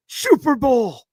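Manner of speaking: mock old man voice. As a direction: slip into the old man's voice by mistake. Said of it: "talking in an old man voice".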